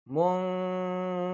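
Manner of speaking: মুনকাদারত with the noon hummed through the nose; ghunna held. Shown as a demonstration. Teacher showing students the nasal in মুনকাদারত. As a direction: hold the nasal মুং in মুনকাদারত. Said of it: "গুন্না করতে হবে".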